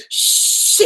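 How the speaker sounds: loud, exaggerated "shh"; secretive shushing. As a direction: imitate a secretive Scorpio shushing someone. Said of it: "Scorpios are always like".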